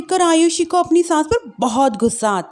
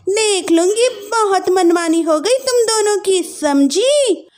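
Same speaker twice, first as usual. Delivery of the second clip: higher, haughty warning; threatening sister-in-law; 7 semitones higher than usual. Acted. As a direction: scold the new bride.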